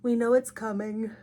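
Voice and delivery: ordinary delivery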